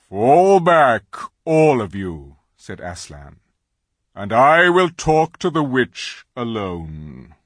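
loud, slow lion command. Acted. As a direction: order them back. Aslan commands everyone.

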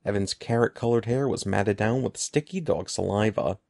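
plain reading voice

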